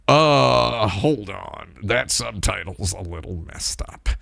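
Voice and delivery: quietly interject like a know-it-all; mansplainer. Retold or imitated. Self-conscious viewer interrupting to flag a bad subtitle, mock mansplainer. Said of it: "mansplainer for constantly, constantly mentioning".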